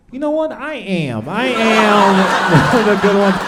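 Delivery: high, smug, slow and laughing. Retold or imitated. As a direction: puff up proudly at the compliment.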